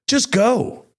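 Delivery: ordinary talk, nothing marked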